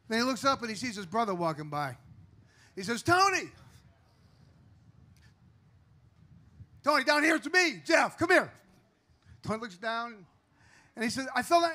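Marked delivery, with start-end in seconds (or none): 3.0-8.5 loud, high call to his brother; trapped man shouting up to his passing brother. Acted. Calls brother from the hole. 11.3-11.7 urgent. Acted. Starts telling Tony.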